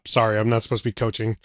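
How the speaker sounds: ordinary delivery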